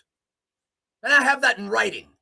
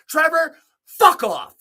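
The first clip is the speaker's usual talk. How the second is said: higher, louder, slow; angry snap; 9 semitones higher, 7 dB louder, slower than usual. Real, not acted. Telling Trevor from the compensation board off, loud. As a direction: tell him off hard.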